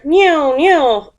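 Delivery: high, loud falling plane whine; toy airplane noise. A narrator's reading. Baby Bear playing airplanes in bed, whining engine noise. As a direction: swoop down like a child's airplane. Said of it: "pretending to be an airplane".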